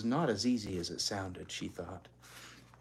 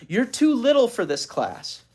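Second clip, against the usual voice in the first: higher, louder protest; protesting sister; 10 semitones higher, 8 dB louder than usual. Acted. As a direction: push back at the little sister. Beezus protests to Ramona.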